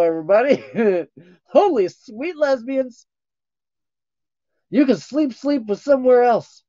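high, loud teasing Deadpool voice; playful high mock-flirty character voice. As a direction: tease them as a cheeky Deadpool.

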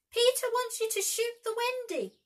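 very high, loud tiny fairy voice; a jealous fairy piping shrilly; shrill fairy voice. A narrator's reading. Tinker Bell lies to the Lost Boys in a shrill high voice. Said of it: "Tinker Bell's shrill voice".

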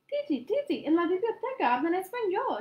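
put-on high, loud eager child; excited exclaim. A narrator's reading. Boy exclaiming to his aunt, excited. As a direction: burst out with the news. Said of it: "exclamó Hildemar".

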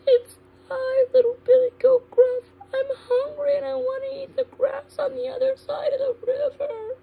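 put-on tiny, very high-pitched kid goat; small little goat voice. Little goat answers the troll, small high voice. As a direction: squeak it out way up high. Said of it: "answered in his small little Billy Goat voice".

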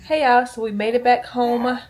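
usual speaking voice

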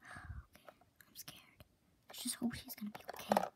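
fast frightened whisper; scared whisper. Acted. Friend frets about Riley, whispering in fear. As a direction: whisper it quickly, worried.

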